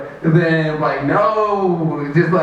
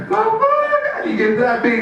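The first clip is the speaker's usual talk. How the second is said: high-pitched, hammed-up shock; stunned admirer. Admirer seeing how ripped he is, stunned. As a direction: squeak out the awed question.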